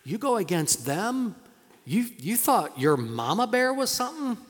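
ordinary talk, nothing marked

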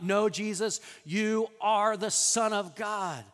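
bold declaration. Retold or imitated. Peter declaring who Jesus is while others hem-haw, bold and loud. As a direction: declare it boldly, slowing on the last words.

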